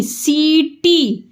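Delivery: सीटी very slow, सी stretched; slow syllables. Shown as a demonstration. Teacher slowly modelling सीटी for pupils. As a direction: drag out सी in सीटी. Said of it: "सी खींच के बोला".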